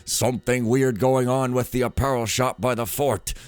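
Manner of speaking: emphatic, forceful character read; note writer in character. Acted. Player reading a note on Frank's locked shop, forceful character voice. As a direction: read it out like a suspicious informant's report.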